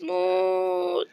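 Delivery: high, very slow die; stretched die. Real, not acted. Gamer urges a mob to die. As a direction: drag the word out teasingly.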